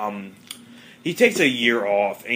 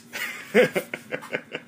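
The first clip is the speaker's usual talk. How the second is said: airy high giggle; laughing at the joke just told; high breathy laugh; 11 semitones higher than usual. Real, not acted. Laughs at own retelling.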